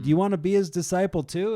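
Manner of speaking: high, loud, fast taunt; mock annoyed. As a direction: needle them, acting fed up.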